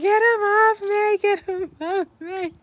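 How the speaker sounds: high, slow pleading cry; hammed-up wail, words dragged out; panicked wail. Begging classmate to remove the spider, panicked.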